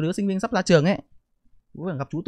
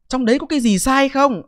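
higher, louder indignant challenge; 8 semitones higher, 7 dB louder than usual. Real, not acted. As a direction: fire the question back at the critics. Defiant rebuttal to critics.

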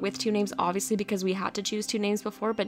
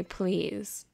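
ordinary talk

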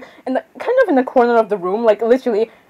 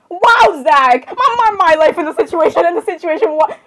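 high, loud cry of disbelief; hammed-up squeal of indignation; indignant outburst; 6 semitones higher, 4 dB louder than usual. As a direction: burst out high and outraged. Acted outburst at Zach.

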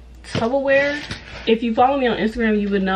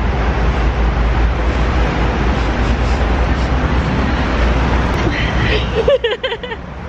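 long high giggle; giggling through the goodbye; high breathy laugh; 13 semitones higher, 4 dB louder than usual. Real, not acted. Laughing at her own drama.

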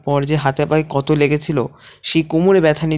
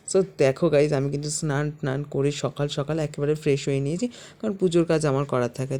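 usual speaking voice

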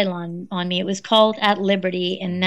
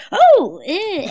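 put-on elderly mum voice; 9 semitones higher, 4 dB louder than usual. Mother voiced in phone story.

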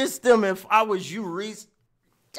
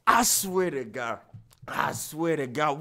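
quiet and low; 9 semitones lower, 8 dB quieter than usual. Real, not acted. Suddenly low after hype.

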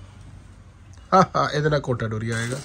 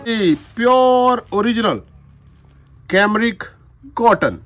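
dramatic loud aunty; 9 semitones higher, 6 dB louder, slower than usual. Real, not acted. Hyping the pink suit.

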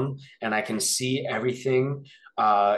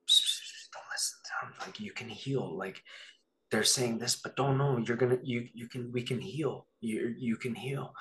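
soft unvoiced secret whisper; 9 dB quieter than usual. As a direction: whisper it close, urging. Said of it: "whispering to me".

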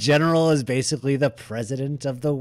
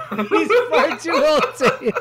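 laughs through a higher repeat of the line; cracking up while echoing the joke; giggling echo; laughing; 12 semitones higher, 3 dB louder, slower than usual. Real, not acted.

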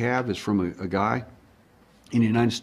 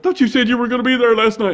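high, loud whiny reproach; loud mock-plaintive protest; imitated upset woman; 13 semitones higher, 8 dB louder than usual. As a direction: mimic her complaint in a high voice. Voicing her upset reproach.

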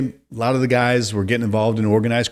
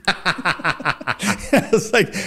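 laughing; 8 semitones higher, much slower than usual. Real, not acted. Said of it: "laughs while telling".

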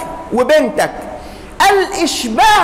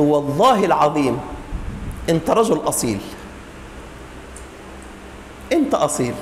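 quiet, low grateful wife; soft grateful; 10 semitones lower, 7 dB quieter than usual. Acted. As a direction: praise him softly. Calmed wife praising husband.

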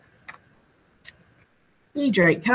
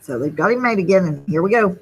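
plain reading voice

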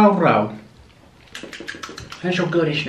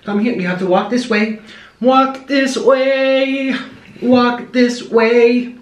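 high coaxing pet voice; 7 semitones higher, slower than usual. Real, not acted.

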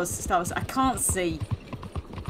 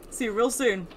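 usual speaking voice

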